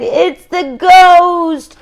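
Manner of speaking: high, loud, slow terrified cry; frightened cry. Acted. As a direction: scream it as the ghost appears. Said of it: "everyone cried".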